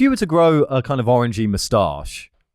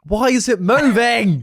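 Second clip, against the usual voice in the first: mock alarm; 13 semitones higher, 5 dB louder, slower than usual. Mock alarm at a moving poo.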